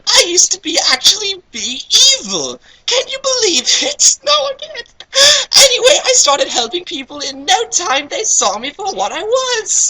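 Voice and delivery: put-on very high falsetto girl, chatty; cockney-ish falsetto girl. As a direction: rattle it off in a squeaky girl voice. Knights voiced in falsetto.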